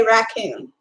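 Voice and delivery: drawn-out raccoon as RACK-oon; Texas pronunciation of raccoon. Shown as a demonstration. Streamer explains Texans say it so, slow RACK-oon. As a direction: slowly give raccoon a full "rack" vowel. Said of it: "'Cause I'm from Texas. We say raccoon".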